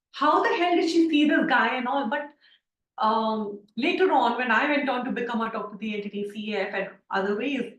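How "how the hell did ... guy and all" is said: higher-pitched retold disbelief; mildly astonished, voice lifted in recalling it; incredulous. Student recalling her reaction to a facilitator's ghost story, incredulous.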